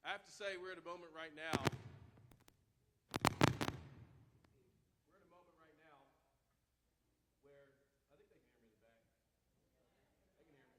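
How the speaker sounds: projecting to the back row; teacher voice. Real, not acted. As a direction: carry it to the back without a mic. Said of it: "Use my teacher voice".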